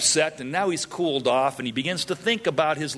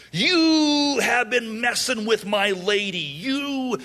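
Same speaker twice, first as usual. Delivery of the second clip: high, drawn-out mock outrage; angry; 8 semitones higher, slower than usual. Samson accusing the men over his bride, hammed-up anger. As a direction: wail the accusation, stretching the words. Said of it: "He's angry".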